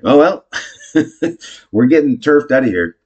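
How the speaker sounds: high, loud, laughing it off; acted laughing shrug; mock cheery resignation. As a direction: laugh off disaster at the top of your voice. Acted cheerful acceptance of firing.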